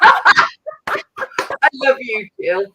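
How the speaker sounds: loud, high, drawn out through laughter; laughing affection. Real, not acted. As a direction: gush it while cracking up. Cracking up at Jill's joke.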